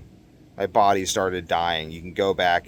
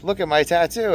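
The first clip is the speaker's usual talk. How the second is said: imitating daughters; 10 semitones higher, 3 dB louder than usual. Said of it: "and they're like".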